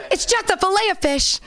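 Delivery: high mock mom pleading. His mom excusing her McDonald's order, high mock plea. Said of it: "Old habits die hard".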